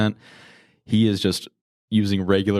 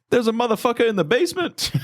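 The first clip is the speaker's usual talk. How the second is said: Sam Jackson impression. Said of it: "Sam Jackson would be like".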